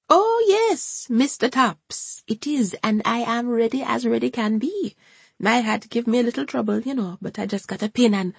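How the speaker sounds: high, Jamaican-lilting grandmother; a high, fussy Jamaican gran chatting; Hortense's Jamaican voice. A narrator's reading. Hortense eager to please Ryan.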